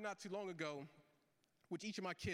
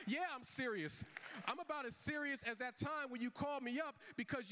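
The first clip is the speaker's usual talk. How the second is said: higher and pushed, a sarcastic dad lecture; mock serious dad; 7 semitones higher than usual. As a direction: pile on every favour you did for her. Dad lecturing daughter in story.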